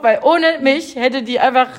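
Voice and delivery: usual speaking voice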